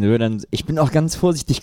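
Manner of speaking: normal talking voice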